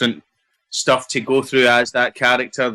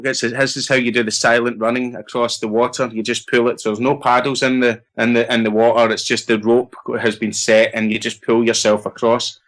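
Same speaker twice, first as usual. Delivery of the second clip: ordinary delivery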